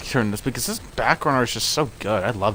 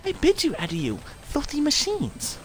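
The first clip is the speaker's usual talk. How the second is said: high-pitched villain; 12 semitones higher, 5 dB quieter than usual. Acted. Gamer mimics a game villain taunting machines in a high voice. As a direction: sneer at the machines in a thin high voice. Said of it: "like, high-pitched voices".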